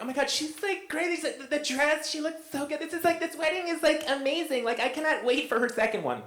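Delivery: high, breathless gushing bridesmaid voice; squealing fake-sweet wedding guest. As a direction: gush like a catty bridesmaid faking delight. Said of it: "They're like".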